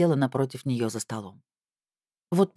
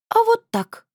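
put-on high, louder defiant teen retort; stubborn; 5 dB louder, slower than usual. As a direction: toss it back stubbornly. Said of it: "упрямо вздёрнула подбородок".

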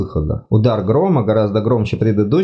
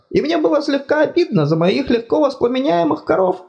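high fussy god voice, apologetic; flustered deity, much higher pitched; embarrassed god. Acted. God complains.

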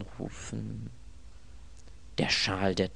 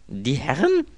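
higher, louder echo, rising at the end; taken aback by the words; startled question; 7 semitones higher, 6 dB louder than usual. Acted. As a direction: query it in surprise. Echoes servant's words in disbelief.

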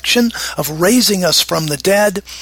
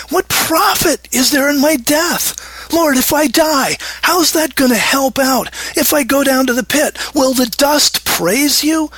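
higher, pleading shout at God; desperate cry to God; 7 semitones higher than usual. Acted. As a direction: cry the verse out like a plea for life. Rereads verse nine as desperate cry.

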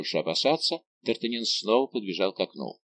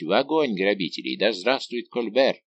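plain reading voice

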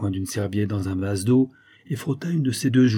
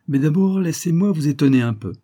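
higher, louder, assured visitor; stranger announcing surprise; 6 semitones higher, 6 dB louder than usual. A narrator's reading. (inconnu sûr de lui)

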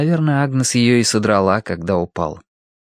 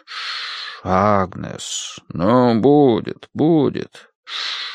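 lulling soothing voice; much slower than usual. A narrator's reading. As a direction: lull a crying child. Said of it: "своим убаюкивающим голосом".

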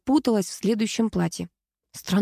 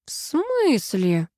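slow, raised, stretched-out question; drawling; 5 semitones higher, much slower than usual. A narrator's reading. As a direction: drag the words out, puzzled. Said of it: "протянула Ксения".

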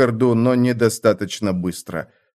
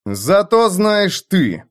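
higher, slow menace; said through teeth; 8 semitones higher, slower than usual. A narrator's reading. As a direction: grind it out slowly. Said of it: "процедил Лёд".

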